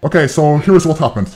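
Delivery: usual speaking voice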